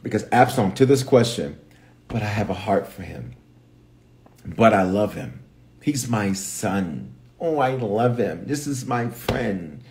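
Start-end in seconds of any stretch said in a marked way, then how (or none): 2.1-9.8 soft, slow, sentimental pleading; tender plea. Retold or imitated. Defending a wayward son one loves, tender plea. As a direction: plead softly for someone you love.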